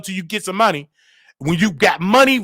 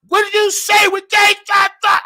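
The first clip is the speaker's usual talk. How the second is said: online show voice; 13 semitones higher than usual. Shown as a demonstration. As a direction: blast it out for the online crowd. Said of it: "theatrics is to entertain you".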